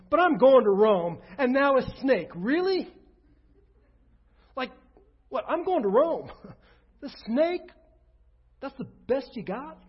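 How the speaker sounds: a fed-up apostle rolling his eyes at a snake; exasperated Paul. Pretend. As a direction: scoff at the snake as Paul, indignant.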